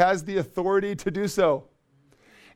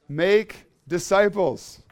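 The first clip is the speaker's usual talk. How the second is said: "Make disciples" slow and louder; stress on make disciples; 3 semitones higher, 5 dB louder, much slower than usual. Shown as a demonstration. Pastor answering his own question, slow and emphatic. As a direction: drop "Make disciples" slowly, word by word. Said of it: "hint, hint".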